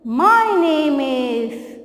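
"My name is" drawn out very slowly; slow model answer. Shown as a demonstration. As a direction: stretch every word for the children. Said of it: "तो क्या बोलना है?".

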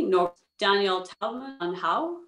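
usual speaking voice

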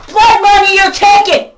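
shouting accusation, enraged; enraged yell at the thieves; furious shout. Real, not acted. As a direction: yell it in real fury. Angry rant at people.

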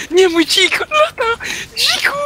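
high desperate wail; panicked cry. Real, not acted. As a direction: scream as the boar flies off. His boar flies away.

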